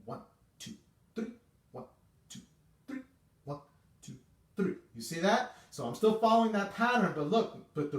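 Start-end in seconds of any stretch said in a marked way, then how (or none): 0.0-4.7 whispered, clipped beat count; short detached counts under the breath; breathy staccato one two three. Shown as a demonstration. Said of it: "which is short and disconnected".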